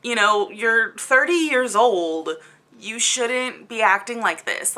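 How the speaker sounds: ordinary talk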